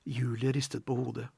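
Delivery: usual speaking voice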